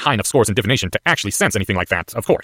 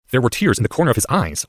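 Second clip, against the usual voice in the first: usual speaking voice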